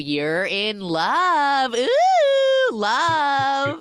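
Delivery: high, loud teasing croon on love; singsong mock croon of love; teasing sing-song. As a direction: tease her like a schoolkid.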